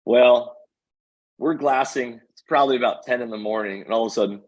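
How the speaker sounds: ordinary talk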